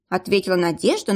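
ordinary delivery

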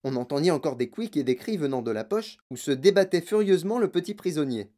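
usual speaking voice

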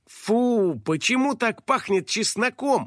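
put-on high-pitched disgusted girl; slow, high complaint at a stink; girl's exclamation. A narrator's reading. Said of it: "воскликнула девочка".

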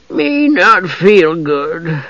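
very slow, high, queasy creature; sickly simpleton drawl; thick-voiced gully dwarf. A narrator's reading. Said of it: "the gully dwarf said thickly".

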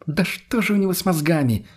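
высокий, раздражённый возглас; возмутись повыше, на пределе терпения, но без крика; психанув. A narrator's reading. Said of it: "уже окончательно психанула Лена". (потеряв терпение, высоким голосом)

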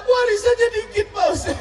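high, loud comic whine; mock whiny widow. Widow complains to preacher. (istri mengeluh kepada ustaz, merengek tinggi)

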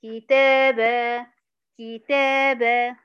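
كتابة held very slowly, stopping on the end with no nun; pausal form. Shown as a demonstration. Teacher showing students the pausal form, very slow. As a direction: stop on كتابة and drop the nun. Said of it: "أقف عليها هقول إيه".

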